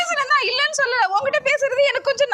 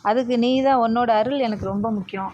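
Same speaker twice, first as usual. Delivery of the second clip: praying; 12 semitones lower than usual. Acted. Praying to god at temple.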